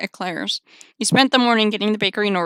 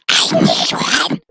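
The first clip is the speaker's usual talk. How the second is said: slow rasping whisper; creepy Gollum-ish voice; much slower than usual. Acted. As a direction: rasp it like Gollum. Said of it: "Are you Gollum?".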